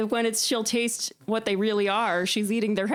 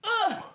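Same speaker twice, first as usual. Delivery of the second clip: disgusted groan. Retold or imitated. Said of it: "And I was like".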